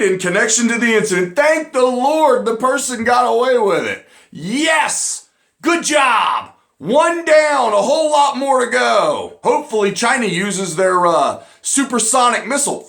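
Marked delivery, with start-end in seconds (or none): 4.3-9.4 gleeful cheer. Real, not acted. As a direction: cheer it like a win. Cheering news of death.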